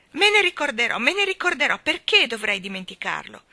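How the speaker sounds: put-on high, loud baby tantrum; furious protest. A narrator's reading. Baby Annabella protests to the starling, furious. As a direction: insist hotly, pitched right up. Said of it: "lanciava piccoli calci furiosi".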